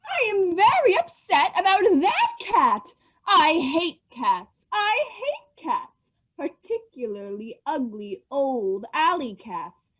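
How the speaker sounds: stern mother. Acted. Mother refusing to feed the stray cat, slow and scolding. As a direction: drag out each word like a cross parent refusing. Child plays the angry mother.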